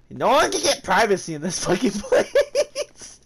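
slow gripe breaking into a laugh; complain, then crack up at the end; laughing complaint. Real, not acted. Gamer complains about privacy, laughing exasperation.

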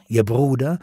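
usual speaking voice

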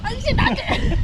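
startled shout. Real, not acted. Splashed with hot water.

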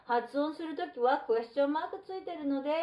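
ordinary delivery

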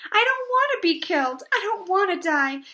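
high, loud panicked wail; screamed. A narrator's reading. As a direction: plead frantically for your life. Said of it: "screamed Wilbur, racing up and down".

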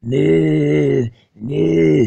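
lazy mooing; mock cow moo. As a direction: moo like a cow to insult her. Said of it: "the noise my sister makes".

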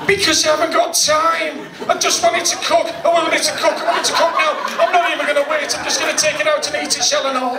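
high, frantic rasping rant; Johnny Vegas impression. Impatient cook ranting about his egg in a high voice. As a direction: rant like an impatient comic who can't wait. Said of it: "which is the Johnny Vegas".

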